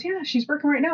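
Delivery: high, bright reply; cheerful server. Retold or imitated. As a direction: answer high and chipper. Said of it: "she goes".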